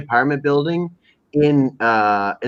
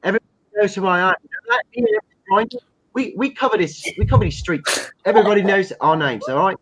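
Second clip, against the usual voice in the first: put-on English street accent; 6 semitones higher than usual. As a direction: play a cocky English street MC. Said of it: "a dirty part of England".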